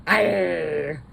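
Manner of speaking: put-on child's pirate growl; playful pirate arr; toddler pirate arr. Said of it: "Is she a pirate?".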